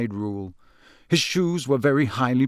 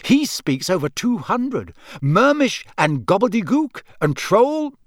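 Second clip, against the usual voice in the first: higher-pitched, eager gushing; excited Percy; 7 semitones higher than usual. A narrator's reading. Eager underling boasting about Mister Crouch's languages, excited. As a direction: blurt it out, writhing with excitement. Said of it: "writhing with excitement".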